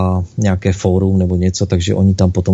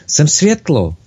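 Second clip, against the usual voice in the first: higher, eager little soul's cry; exclaiming; 8 semitones higher, slower than usual. Acted. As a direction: burst out with joy as the little soul. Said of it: "malinká duše vykřikla".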